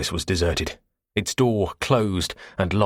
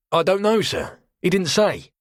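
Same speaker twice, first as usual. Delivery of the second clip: cockney quartermaster; 11 semitones higher, 3 dB louder than usual. Acted. Cockney quartermaster voiced high.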